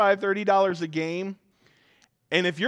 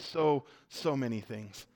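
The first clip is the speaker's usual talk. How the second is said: deadpan admission; 4 semitones lower, 8 dB quieter, slower than usual. Retold or imitated. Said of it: "I'm like".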